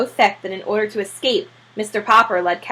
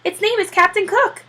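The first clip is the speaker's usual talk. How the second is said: proud owner; 11 semitones higher than usual. Acted. Mr Popper proud, high.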